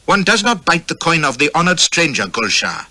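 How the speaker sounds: a prince rebuking a rude guest; rebuke. A narrator's reading. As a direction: scold him with cold courtesy.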